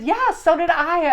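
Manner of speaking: high, loud, slow and emphatic; bursting with it, drawing each word out; excited. Real, not acted. Medium answers client who also smelled popcorn, thrilled.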